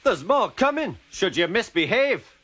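high-pitched, cross threat; angry warning. A narrator's reading. Donald threatens the van.

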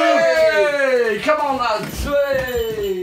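high, loud cheer to the group; rally the lads like a terrace chant; rousing shout. Acted. Rousing friends for the Queen's Speech, cheering shout.

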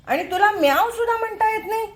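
quick, high teasing to a cat; teasing pet talk. Real, not acted. Teasing the cat that cannot meow, high pet talk. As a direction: tease the cat fondly.

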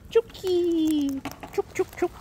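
high sing-song chook call; calling chickens. Real, not acted. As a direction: call them in, high and light. Calling the bird to food.

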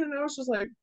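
ordinary talk

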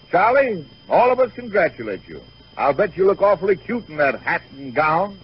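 normal talking voice

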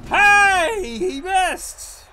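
high, loud burst of surprise; surprised outburst. Real, not acted. Reacting to an opponent's miss, loud surprised yell. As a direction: yelp at the lucky miss.

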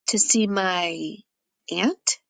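"To see my aunt?" said slowly, with the voice rising sharply on "aunt?"; rising doubtful tone. Acted. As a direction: let "aunt" lift up like an unsure question, betraying the lie. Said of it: "my tone goes up at the end".

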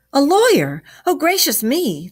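high, loud, alarmed exclamation; concerned. A narrator's reading. Rat frets to himself over the lawyer, concerned. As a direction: exclaim in concern, pitched right up. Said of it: "the concerned rat said to himself".

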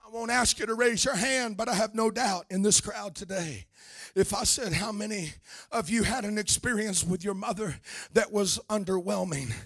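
ordinary talk

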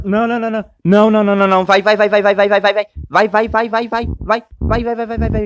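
répète vite et fort, paniqué; pousse, pousse, dans l'urgence; panique pressée. Real, not acted.

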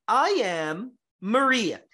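slow, with "am" and "Ria" in "Maria" both stressed. Shown as a demonstration. Teacher repeating the stressed model sentence to the class. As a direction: hit "am", then hit "Ria" of "Maria". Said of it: "O Ria do Maria é que é forte".

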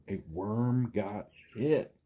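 plain reading voice

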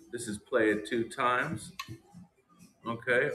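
usual speaking voice

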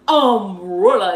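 "Umbrella" slow and loud, falling; drilled English word. Retold or imitated. Recalling how the teacher drilled her, "Umbrella" loudly stretched out. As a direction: draw out every vowel of "Umbrella". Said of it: "Para que me saliera la palabra así".

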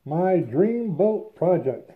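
muffled behind face mask; speaking inside sealed respirator; through a respirator mask. Real, not acted. Seen 0: Cartridge respirator covers mouth and nose throughout; references show bare face.

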